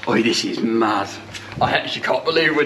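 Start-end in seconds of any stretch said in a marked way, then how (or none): none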